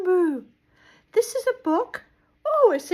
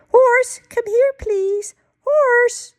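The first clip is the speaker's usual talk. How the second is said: high, raised calling voice; 9 semitones higher, 4 dB louder, slower than usual. Acted. Moo Moo calls Horse over, calling out high. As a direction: call across for someone to come.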